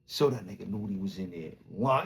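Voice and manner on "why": ordinary delivery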